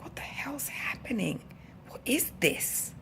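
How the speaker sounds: hushed, high whisper of disbelief; retold panicky whisper to oneself; alarmed whisper. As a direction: whisper it slowly, baffled and alarmed. Acting out alarm on the stairs.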